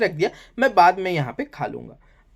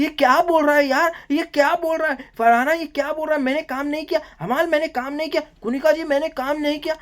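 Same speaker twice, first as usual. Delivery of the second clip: mock outraged Nehal; 9 semitones higher than usual. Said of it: "Nehal बोलती है".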